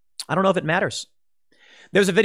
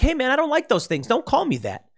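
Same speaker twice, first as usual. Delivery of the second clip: much higher, pleading, nervous-sounding voice; mock defensive plea; 9 semitones higher than usual. Someone accused of racism protesting, pitched high and pleading. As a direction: play the anxious guy denying the charge. Imitating defensive past self.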